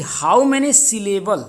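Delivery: drawn out, word by word; slow dictation. Shown as a demonstration. Teacher starting to read the syllable question, slow dictation. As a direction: spell out the question slowly. Reads exam question aloud.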